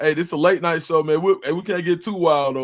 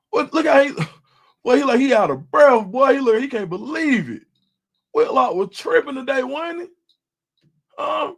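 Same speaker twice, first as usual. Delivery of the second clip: raised, teasing disbelief; high mocking tease; 8 semitones higher, 4 dB louder than usual. Real, not acted. Streamer teasing Whitlock to chat, high mock disbelief. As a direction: keep roasting him up high.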